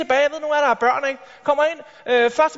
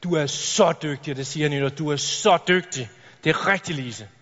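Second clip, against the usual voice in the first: fawning praise; 7 semitones lower than usual. Retold or imitated. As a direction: gush over the favourite pupil. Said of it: "der signalerer".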